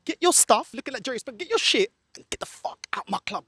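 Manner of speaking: usual speaking voice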